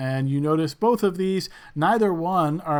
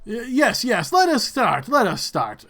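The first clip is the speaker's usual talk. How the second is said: high, raised call to begin; impatient host; 7 semitones higher, 4 dB louder than usual. Acted. Regent cutting in after the trumpets, high impatient host voice. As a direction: hurry the proceedings along as regent.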